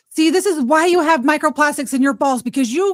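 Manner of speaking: high, loud mock scolding mom; scolding parent. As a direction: lecture your grown kid teasingly. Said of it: "This is what you're gonna say".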